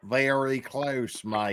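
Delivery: slow, soft imitation of Aussie speech; Australian accent attempt. Shown as a demonstration. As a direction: try out drawn-out Australian vowels, quietly. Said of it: "very close to an Australian accent".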